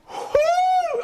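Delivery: high woo from the burn; pained whoop. Real, not acted. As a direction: yelp it out. Hot sauce burns.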